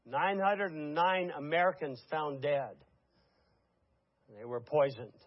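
ordinary delivery

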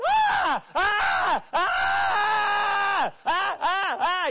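loud, high, repeated panicked screams; mock screaming. Camper screaming from a nightmare, high frantic yells. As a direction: scream over and over in terror.

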